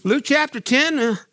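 ordinary delivery

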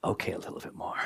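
whispered aside of the hidden meaning. Retold or imitated. Whispering what the host really hears. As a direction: breathe it like a secret.

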